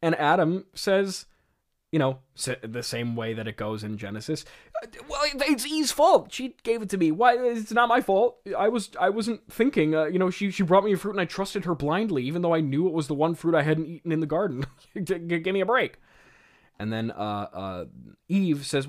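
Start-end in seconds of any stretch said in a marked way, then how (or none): 4.8-16.0 high, stammering blame-shifting; loud, high-pitched imitation of whiny excuses; mock defensive. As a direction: protest your innocence in a hammed-up panic. Acting Adam blaming Eve.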